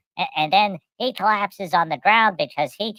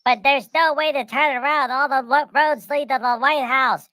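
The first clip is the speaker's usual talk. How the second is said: higher, whiny protest; pleading protest; 7 semitones higher than usual. Retold or imitated. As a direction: complain in a high, put-upon voice. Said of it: "and then you say".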